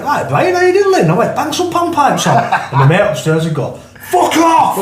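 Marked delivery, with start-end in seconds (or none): 0.0-2.4 very high-pitched, over-keen mock greeting; hammed-up fake keenness; feigned enthusiasm. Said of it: "used to pretend we liked him". 4.1-4.8 high-pitched imitated holler; retold outburst, pitched right up; mock yell. Mate calling from upstairs in story.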